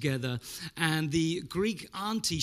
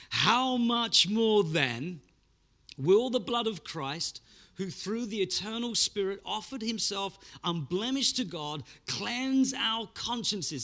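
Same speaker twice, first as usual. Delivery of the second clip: scripture reading; 4 semitones higher than usual. Acted. Slow reading of the key verse.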